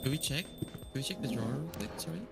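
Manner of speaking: usual speaking voice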